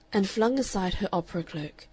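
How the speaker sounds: ordinary delivery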